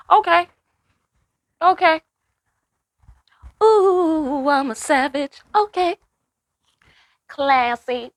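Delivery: very high mock sassy savage; sassy diva. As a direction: squeal it like a proud diva. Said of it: "I have to say it like that".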